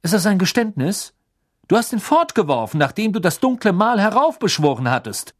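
hohe, laute Beamtenstimme; strenger Beamter. A narrator's reading. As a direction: streng und von oben herab. Diggory accuses. (strenger Ministeriumsbeamter)

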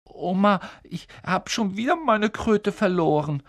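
put-on high, plaintive boy's wail; whiny boy complaining. A narrator's reading. Boy whining to his grandmother about his toad. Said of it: "hörte ihn klagen".

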